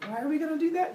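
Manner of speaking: high, put-on questioning voice; puzzled humans. Humans asked by Zeus to sacrifice, puzzled. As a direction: whine it high, as a puzzled villager.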